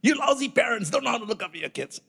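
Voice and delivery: high, whiny bully's jeer; mocking rant. Passenger jeering at the parents again, mocking high rant. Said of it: "He starts his nonsense again".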